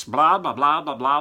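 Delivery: usual speaking voice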